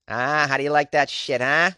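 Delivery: put-on Boston accent, drawn into a challenge. Shown as a demonstration. Podcaster obliging a listener who likes it, showy Boston accent. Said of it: "give you more Boston accent".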